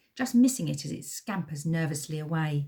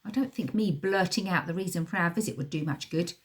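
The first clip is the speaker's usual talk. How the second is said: ordinary delivery, nothing marked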